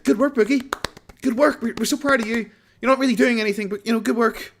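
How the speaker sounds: sarcastic sing-song approval; mock fans. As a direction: coo it like talking to a child. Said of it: "they'll be like".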